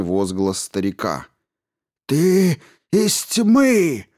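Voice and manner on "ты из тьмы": high, loud, slow hissing fury; furious hiss. A narrator's reading. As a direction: spit it out in rage. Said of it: "прошипел он".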